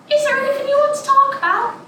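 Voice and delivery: high, quick, gentle questioning; teacher voice. Retold or imitated. Teacher asks a hiding girl to talk, high and quick. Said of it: "She says".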